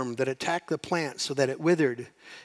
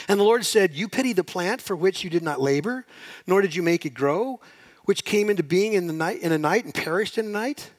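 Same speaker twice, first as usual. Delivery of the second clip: loud, rising scripture read; preaching God's words; 4 semitones higher, 5 dB louder than usual. Acted. Preacher reading God's words to the congregation, loud and rising. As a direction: proclaim it over the crowd.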